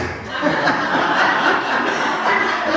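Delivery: high, breathless burst of laughter; laughing. Real, not acted. Preacher laughing at his own cheeky joke in the pulpit. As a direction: crack up at your own joke.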